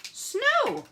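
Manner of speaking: high, loud delighted exclaim; excited reveal. Real, not acted. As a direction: announce the result with glee. Triumphant reveal of finished snow.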